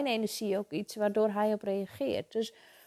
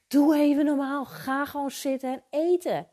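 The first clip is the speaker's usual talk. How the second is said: re-enacted cross mother, higher, louder at first; boze moeder; 4 semitones higher, 4 dB louder than usual. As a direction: scold a child at the table, mildly acted. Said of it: "soms ben ik ook gewoon boos". (nagespeelde boze moeder, hoger van toon)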